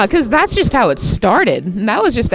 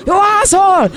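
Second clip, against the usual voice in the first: loud, high mock Vietnamese yelling; slow, high-pitched caricatured Vietnamese rant; Vietnamese impression; 8 semitones higher, 4 dB louder than usual. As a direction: shout it in a hammed-up Vietnamese accent. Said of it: "doing their best Vietnamese impression".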